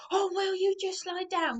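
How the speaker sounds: mock-exasperated partner pleading; exasperated mocking high voice.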